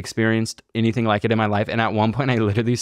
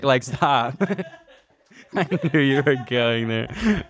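laughing; 4 semitones higher than usual. Real, not acted. Laughing off friend's joke.

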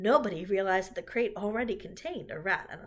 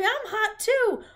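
high, loud, drawn-out whine; boyish cry; 10 dB louder, slower than usual. A narrator's reading. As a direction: yell it like a clamoring boy. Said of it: "cried Avery".

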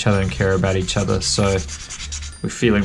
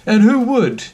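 very high, far-off sneering bird voice; mocking flamingo; 12 semitones higher, slower than usual. Flamingo taunting Charlie, very high and snide. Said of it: "asked the flamingo".

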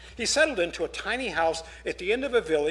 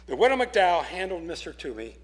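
plain reading voice

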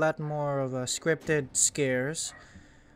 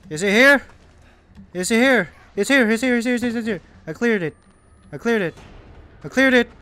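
excited yelling; 6 semitones higher, 7 dB louder, much faster than usual. Real, not acted. Frantic searching, screaming in game.

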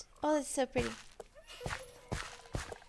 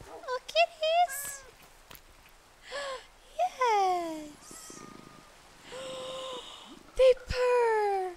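high, slow, louder delighted cooing; gushing over kitties; 11 semitones higher, 7 dB louder, much slower than usual. Real, not acted. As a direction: melt over the purring cats. Gushing over purring cats.

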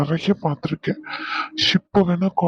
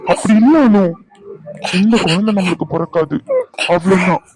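exasperated outburst; 2 semitones higher, 5 dB louder than usual. Acted. Husband contradicts his despairing wife, exasperated outburst. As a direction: cut in forcefully to contradict.

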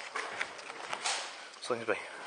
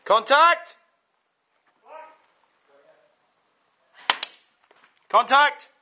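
sharp high yell of alarm; shouted warning; 13 semitones higher, 7 dB louder, much slower than usual. Real, not acted. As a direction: warn the whole team loudly. Spotting enemy, warning team.

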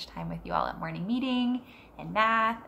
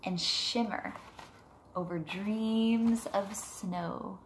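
put-on reading to children; 6 dB quieter than usual. Teacher reading Moon Wishes to her class, gentle storybook voice. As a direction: read it softly to a class of kids. Reads picture book aloud.

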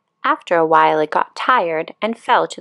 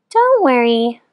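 kindly snail; 6 semitones higher, 3 dB louder than usual. Acted. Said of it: "said the snail".

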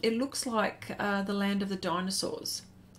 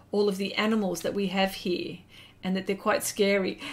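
ordinary delivery

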